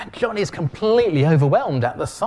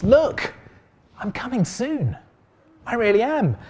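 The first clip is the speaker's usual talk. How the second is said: high, raised proclamation, loud at the start; big voice; 9 semitones higher than usual. Acted. Preacher voicing Jesus to sleepy Christians, big high voice. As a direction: call it out high to rouse the drowsy. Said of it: "in a big loud voice".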